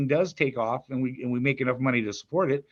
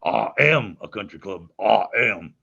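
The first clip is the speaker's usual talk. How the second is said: pompous bear, weight on "am"; Bear's voice; 6 semitones lower, 3 dB louder, slower than usual. Shown as a demonstration. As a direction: lower voice, stress on "am". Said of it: "That's the vocal inflection".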